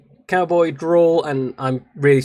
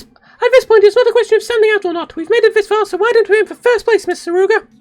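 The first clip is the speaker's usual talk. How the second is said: put-on very high, loud eager girl; a shrill cartoon pep talk; high squeaky anime girl; 7 dB louder than usual. Urging Mister Tsuruga to aim for first, very high squeaky girl voice.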